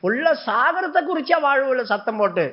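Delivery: ordinary delivery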